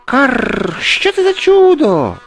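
very high, alarmed crow squawk; timid crow cry. A narrator's reading. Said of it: "боязко крикнула ворона". (боязкий крик ворони, дуже високо)

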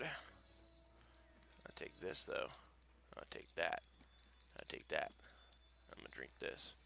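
unvoiced whisper to himself. Real, not acted. Gamer drinks a potion in a whisper. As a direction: hush it out as you grab the items. Whispers while using items.

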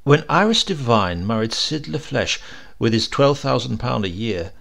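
ordinary delivery

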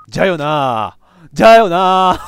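loud, triumphant yes; loud exclamation. Real, not acted. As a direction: shout it out in triumph. Realizing the puzzle answer.

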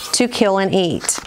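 plain reading voice

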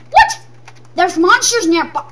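very high, loud startled cry; comic surprise; shocked squeal. Pretend. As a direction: yelp in mock alarm. Sudden surprise.

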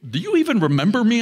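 desperate demand for recognition; agitated pleading. Acted. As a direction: press him hard, almost shouting. Pleading with the president hologram.

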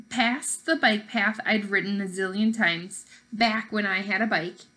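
plain reading voice

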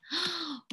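soft drawn-in breath; mock-amazed gasp. Storyteller amazed at the ice cream, mock gasp. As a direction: gasp in pretend wonder.

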